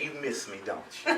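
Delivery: dropped low and soft; soft, knowing murmur to a wife; sly teasing comeback. Retold or imitated. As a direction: tease her quietly, as if seeing through her. Playful comeback to fussing wife.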